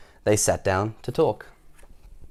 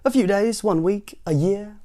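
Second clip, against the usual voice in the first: breezy clumsy cousin; 8 semitones higher, slower than usual. A narrator's reading. Cousin listing possible stays.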